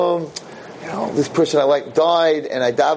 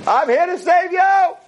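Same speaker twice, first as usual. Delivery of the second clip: heroic proclamation; slower than usual. Acted. Moshe proudly announces rescue.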